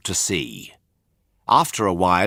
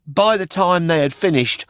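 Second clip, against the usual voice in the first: put-on higher, quick puppet boy telling his tale; Pinocchio voice; 6 semitones higher, faster than usual. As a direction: chatter it like an eager puppet. Pinocchio tells his story.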